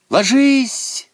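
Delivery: high, loud warning cry; urgent headmistress's yell; shouted command. A narrator's reading. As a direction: order everyone to hit the floor. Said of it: "закричала она".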